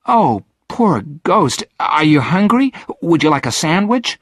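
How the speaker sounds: high, eager sympathy; high-pitched, anxious concern; concerned girl. A narrator's reading. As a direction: fuss over the poor ghost, pitched high. Girl pities the starved ghost.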